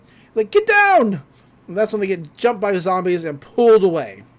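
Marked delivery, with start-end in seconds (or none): 0.5-1.2 high, loud "Get down!"; shouted warning. Acted. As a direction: yell it as a sudden warning. Agent warns of zombies.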